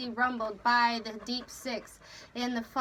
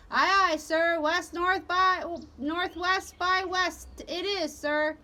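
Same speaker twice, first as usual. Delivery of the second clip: slow, raised call back; helmsman's answer; 6 semitones higher, 5 dB louder than usual. A narrator's reading. Helmsman repeating the captain's course, calling out. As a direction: sing out the order in reply.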